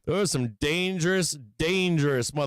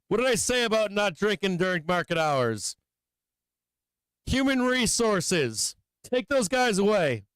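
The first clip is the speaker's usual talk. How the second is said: raised, hammed-up scolding boss voice; mock strict boss; 5 semitones higher than usual. Streamer scolding chat moderators like a pretend strict boss. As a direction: play the angry boss scolding tipsy mods.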